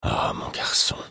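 whispered with pity; soft whispered pity. A narrator's reading. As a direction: breathe it out with pity. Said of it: "d'un ton empreint de pitié".

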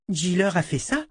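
put-on high-pitched, surprised child's question; little girl. A narrator's reading. Girl learns who transformed the wolf, high amazed voice.

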